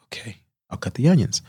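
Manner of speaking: whispered, resigned okay; whispered giving in. Retold or imitated. New cook giving in to the chef, whispered. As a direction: breathe it out under your breath.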